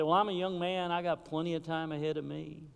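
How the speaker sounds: ordinary talk, nothing marked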